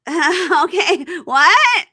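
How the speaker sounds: loud, high laugh through the words; mock nervous laughing. Bride rebuffed by the king, mock nervous laughing. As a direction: imitate a bride cracking up in disbelief.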